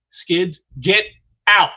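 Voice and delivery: punchy mock order, word by word; acted command. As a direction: order someone out, with breaks between words. Acting out an urgent order.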